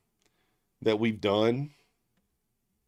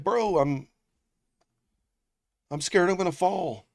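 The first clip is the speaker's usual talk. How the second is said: mock scared friend; 8 semitones higher than usual. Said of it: "He's like".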